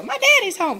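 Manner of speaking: very high, excited dog voice, cooed; voicing the excited dog. Owner voicing her dog greeting daddy, very high and excited. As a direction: squeal it as the dog overjoyed at her owner's return. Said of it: "Look at that tail".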